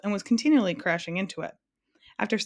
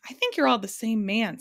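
ordinary delivery, nothing marked

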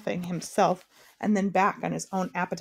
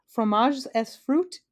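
slow, careful French menu, pitched up; 4 semitones higher, 4 dB louder, slower than usual. A narrator's reading. King ordering his French banquet dishes, careful. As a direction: pronounce each dish with deliberate care. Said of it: "he said carefully".

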